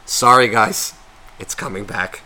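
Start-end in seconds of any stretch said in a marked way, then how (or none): 1.4-2.2 muttered under breath; quiet repeat. Real, not acted. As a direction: drop the voice, mutter it. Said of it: "I'm becoming an asshole".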